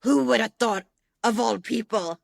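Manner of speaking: a hint of an Italian accent in a teasing grandmother line; imitating Italian accent. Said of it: "she has an Italian accent".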